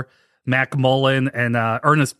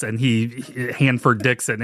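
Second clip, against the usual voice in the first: ordinary talk, nothing marked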